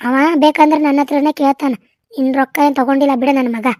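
ordinary talk, nothing marked

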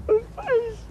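high, breaking sob; crying over what he did to his mother. Acted. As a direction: let the words crack into tears.

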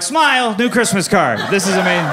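high, loud mock delight; hammed-up excited photographer; gleeful son. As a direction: call out cheerily for a photo. Son snapping photo of arrested parents.

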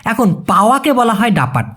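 normal talking voice

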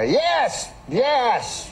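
high, sing-song Cosby voice; Bill Cosby impression. Comedian answering a fan in a high Cosby voice. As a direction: mimic Cosby's odd drawn-out yes. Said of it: "He has that funny way of talking".

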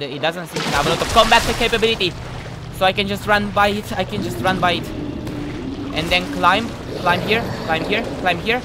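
loud, high-pitched, excited play commentary. Real, not acted. Player hyping themselves running past enemies, excited.

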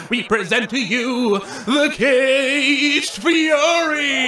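slow, booming announcer shout; milk the big reveal; grand finale shout. Acted. Host unveils the war machine to the crowd, booming.

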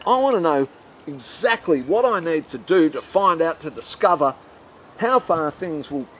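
ordinary talk

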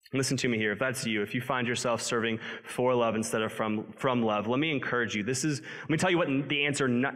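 ordinary talk, nothing marked

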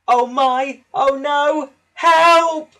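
little engine crying out in panic; panicked cry. A narrator's reading. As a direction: scream for help as the train rushes at you. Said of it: "cried Percy".

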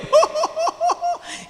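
high mock laugh of the friends; a squealing burst of laughter; shared laugh, high-pitched.

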